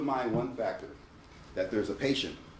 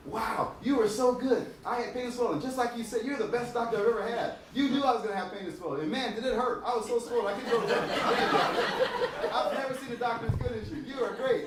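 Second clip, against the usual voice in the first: high, gushing voice of a thankful patient; grateful patient; 11 semitones higher than usual. Retold or imitated. Patient returning to praise the dentist, gushing high voice. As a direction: gush with praise like a fan. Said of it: "they go".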